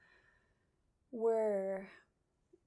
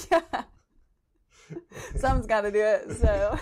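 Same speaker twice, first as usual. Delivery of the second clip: laughing; 13 dB louder than usual. Real, not acted. Guest laughs about heavy topic.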